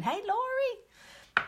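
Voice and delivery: high, slow sing-song hello; drawn-out high greeting. Real, not acted. Greeting a viewer.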